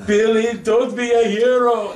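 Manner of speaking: high, loud, slow announcer quote. Retold or imitated. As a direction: boom it out like the ring announcer. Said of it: "What did the announcer say?".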